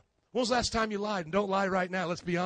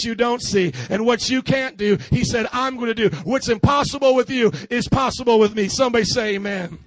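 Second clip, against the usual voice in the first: preaching at full force; 3 semitones higher, 9 dB louder than usual. Acted. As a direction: drive the sermon home to the crowd.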